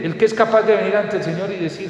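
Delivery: ordinary talk, nothing marked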